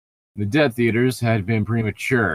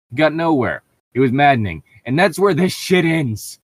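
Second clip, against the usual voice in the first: exasperated; 7 semitones higher, 6 dB louder than usual. Real, not acted. Abruptly ending the story.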